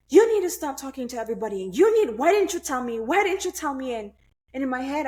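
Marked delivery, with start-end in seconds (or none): 0.1-3.9 mock scolding mom, louder and higher. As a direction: snap at her like an angry mother. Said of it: "my mom is like".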